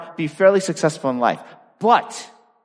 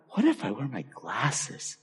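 much softer, slow musing to himself; quiet thought; 2 semitones lower, 12 dB quieter, slower than usual. Retold or imitated. Boy wondering to himself about glasses, softly musing. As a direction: think it aloud under your breath. Private thought, tennis idea.

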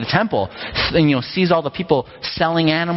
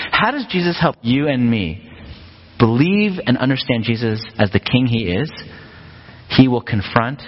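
ordinary talk

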